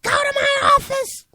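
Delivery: high, loud scream of dismissal; full-force furious yell; screamed order. Retold or imitated. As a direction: scream it at a defiant student. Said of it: "screamed at him".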